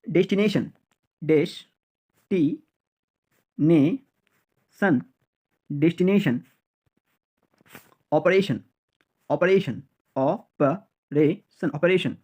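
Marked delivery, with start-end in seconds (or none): 1.2-5.0 destination very slowly as des-ti-ne-son; long pauses between each syllable of destination. Shown as a demonstration. Teacher drilling learners, destination in very slow separate syllables. 10.1-11.7 operation slowly as o-pa-re-son; syllable by syllable. Shown as a demonstration. Teacher drilling learners, operation in slow separate syllables.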